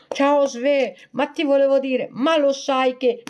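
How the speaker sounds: voce più acuta e un po' più forte; amico invadente imitato. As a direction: imitare l'amico insistente, squillante. Imita l'amico che la tocca. (amico che attacca bottone, con tono acuto e insistente)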